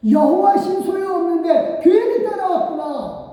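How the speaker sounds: voicing grumbling Israelites, mock wail; mock wailing regret. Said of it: "우는 소리가 무슨 우는 소리야".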